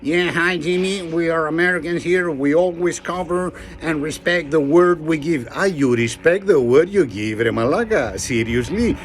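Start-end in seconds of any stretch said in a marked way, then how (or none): 0.0-5.5 lightly hammed American accent. American Dan from Idaho boasting to Jimmy, hammed American accent. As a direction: play the American, mildly. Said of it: "Dan Gordon from Idaho".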